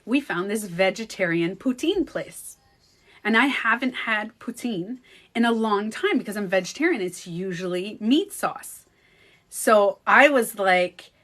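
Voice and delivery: usual speaking voice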